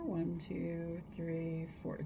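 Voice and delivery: soft, slow counting; slow counts. Shown as a demonstration. Therapist counting a held breath for viewers, soft slow counts. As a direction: count it out gently and slowly. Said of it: "Slow counts".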